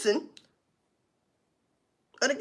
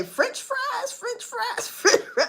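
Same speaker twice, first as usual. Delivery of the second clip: higher, sing-song mocking repeat; sing-song imitation, much higher; mock French fries; 10 semitones higher, much slower than usual. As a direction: tease it like Ratchet misheard it. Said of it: "Like Ratchet used to say".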